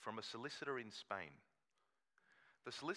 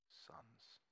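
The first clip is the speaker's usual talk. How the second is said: whispered ending; 19 dB quieter than usual. Acted. Reading that David treats him as a son, a soft whisper. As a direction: breath-only whisper on the last word.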